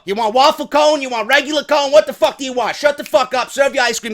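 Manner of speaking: loud, high rapid-fire demands; brusque counter worker. Acted. Server hurrying customers through the line, loud and impatient. Acted brisk, abusive counter service.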